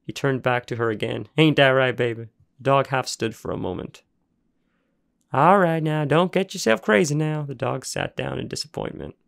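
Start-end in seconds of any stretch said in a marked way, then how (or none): 1.4-7.4 louder, higher gruff coaxing; calm the excited dog down; cooing to dog. Acted. Junkyard man settling his dog, gruff and coaxing.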